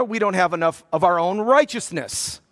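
ordinary delivery

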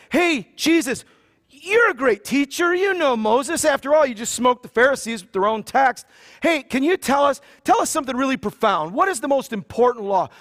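butter up the teacher before springing the trap; mock eager lawyer laying on the praise; flattering lawyer. Lawyer flatters Jesus to trap him.

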